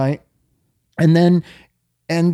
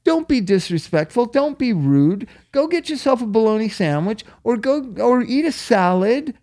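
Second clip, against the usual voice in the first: higher, mock parental lecture; parent lecturing; 7 semitones higher than usual. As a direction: scold a spoiled child like a parent. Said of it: "didn't teach their child, like".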